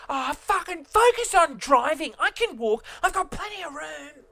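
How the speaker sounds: high-pitched retold yell, held back in volume; mock yell. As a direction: imitate screaming at someone without full volume. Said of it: "at the top of my lungs".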